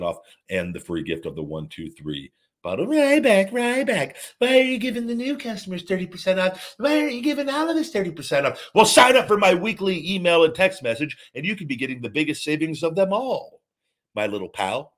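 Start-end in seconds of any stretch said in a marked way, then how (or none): 2.9-13.6 high-pitched whiny customer voice; squeaky high voice. Pretend. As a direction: squeak it like a small pal. Plays squeaky customer asking question.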